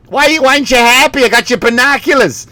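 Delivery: high, pushy Boston parent impression. Parent to an ungrateful kid, high Boston protest. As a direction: protest in a put-on Boston accent. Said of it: "it's a family from Boston".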